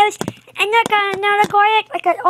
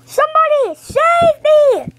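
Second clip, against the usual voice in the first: cry for help; 6 semitones higher than usual. Acted. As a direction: scream for help in a put-on voice. Toy villain crying for help.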